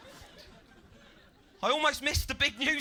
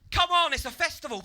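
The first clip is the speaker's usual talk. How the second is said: loud, high mock-goading call; festival catchphrase; 10 semitones higher, 7 dB louder than usual. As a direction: mimic a pushy festival-goer. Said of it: "it's a bit of a catchphrase".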